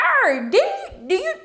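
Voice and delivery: high, loud incredulous call-out; indignant rebuke. Acted. Reviewer calling out Fred over his nerve, indignant. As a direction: confront him in outraged disbelief.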